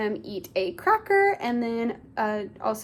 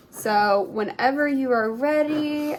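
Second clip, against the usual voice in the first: normal talking voice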